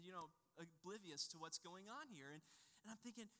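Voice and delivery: ordinary talk, nothing marked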